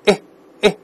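"诶" as a short, open eh; short vowel. Shown as a demonstration. Teacher isolating the middle sound of a word, short open "诶". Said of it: "这个短音应该发诶".

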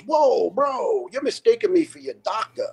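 high-pitched mock brush-off; dismissive doctor. Doctor brushing him off, retold.

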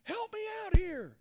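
high, loud mock yell for help; acted high-pitched shout. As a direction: holler for help, building in volume. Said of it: "he yells out to Jesus".

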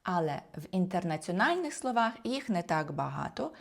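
ordinary talk, nothing marked

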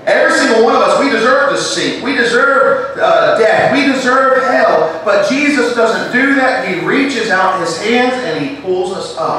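high, loud pulpit delivery; full-force sermon voice; fervent preaching. Acted. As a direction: drive it home to the congregation.

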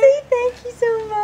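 put-on high sing-song prompt to a toddler; baby talk. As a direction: coax the little one sweetly.

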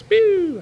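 high pew firework sound; fireworks noise. Retold or imitated. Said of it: "he does some noise".

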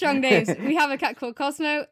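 gushing about the family cat; excited. Real, not acted. Podcaster proudly telling about her own cat, excited.

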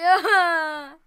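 loud drawn-out "aah" scream; mock scream. Player retelling a skeleton's push, mock scream. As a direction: let out a long, acted-out scream. Reacting to skeleton pushing them off.

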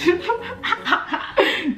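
high, breaking-out laugh; big laugh. Real, not acted. Laughing at her own dad joke, big laugh. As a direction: crack up at your own joke.

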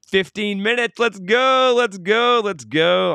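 loud, high rallying cry; excited hype. Real, not acted. Host launching the live chat for the last minutes, excited. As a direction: whoop it up, pitch soaring then coming down.

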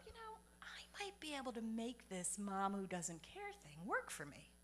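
whispered inner thought; a sly whispered plan; scheming whisper. Retold or imitated. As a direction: whisper the sneaky idea to yourself. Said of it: "I started thinking".

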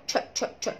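"ت" as repeated voiceless ch bursts; ch sound. Shown as a demonstration. Teacher isolating CH for students, "ت" as ch. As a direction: pop out "ت" as a bare ch. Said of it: "سي اتش تنطق".

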